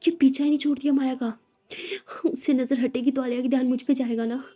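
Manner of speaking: loud, frustrated boss venting; angry yelling. A narrator's reading. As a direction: rant loudly in jealous frustration. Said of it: "boss चिल्लाते". (गुस्से में ज़ोर से बोलती हुई)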